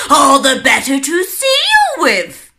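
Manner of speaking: loud, sly wolf voice. A narrator's reading. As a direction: answer as the greedy wolf. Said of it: "the wolf replied".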